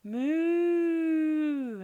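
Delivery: a long, high, loud moo; cow's moo. A narrator's reading. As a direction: moo back like a wary cow. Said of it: "answered Belinda".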